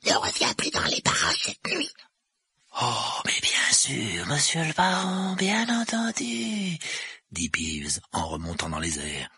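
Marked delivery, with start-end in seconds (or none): 0.0-1.9 rasping, voiceless warning; husky ghostly order; hoarse voice. A narrator's reading. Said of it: "de sa voix rauque". 2.8-6.8 slow, oily agreement rising high; grovelling creature voice, drawn out; fawning poltergeist. A narrator's reading. Peeves fawns on the supposed baron.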